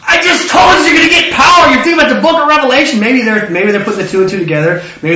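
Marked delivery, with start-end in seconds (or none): 0.1-3.0 high, loud, rushed scolding; mock exasperation. Jesus to dense disciples, mock exasperated outburst. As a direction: play an exasperated teacher at the end of his patience.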